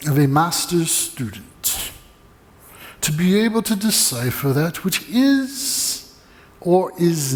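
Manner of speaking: slow, dry Scottish professor, a touch put on; measured Scottish lecture to a cheeky student, slow; dry Scottish accent. Preacher imitating his Scottish professor answering a student, slow and dry. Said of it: "spoke with a dry Scottish accent".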